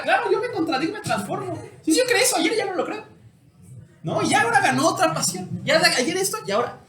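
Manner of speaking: thrilled, laughing enthusiasm; excited. Real, not acted. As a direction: get carried away by the idea. Delighted riff on self-contradiction.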